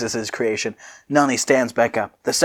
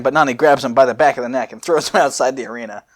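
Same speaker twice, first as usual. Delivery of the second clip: ordinary talk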